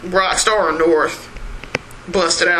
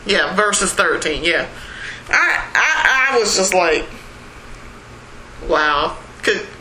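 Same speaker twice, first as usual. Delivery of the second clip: normal talking voice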